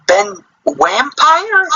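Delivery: Vampire drawn out with a W, high; mock W pronunciation. Shown as a demonstration. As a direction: say Ben Vampire as Ben Wampire. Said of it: "you gotta type in".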